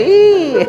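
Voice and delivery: high loud squeal. Real, not acted. Reacting to a painful press with a high loud yelp. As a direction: yelp a little at the touch.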